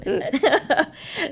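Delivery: high-pitched burst of laughter. Real, not acted. Laughs at coffee joke.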